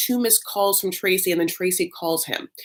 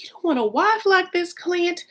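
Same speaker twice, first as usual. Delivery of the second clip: Southern mom; 12 semitones higher than usual. Retold or imitated. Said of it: "trying desperately to get Clint to be like".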